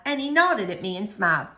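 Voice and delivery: usual speaking voice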